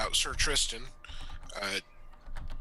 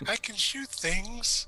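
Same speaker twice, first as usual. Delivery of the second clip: very high, slow, drawn-out comic voice; silly character voice; 11 semitones higher, much slower than usual. Acted. Player parodies the archer joining up, silly high voice. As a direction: put on a goofy high voice, stretched out. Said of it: "that voice is gonna be here".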